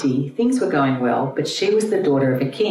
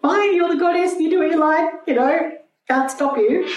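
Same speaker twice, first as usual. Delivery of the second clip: exasperated Shiva giving in. Retold or imitated. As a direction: squeak it out high, like a husband throwing up his hands. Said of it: "He went".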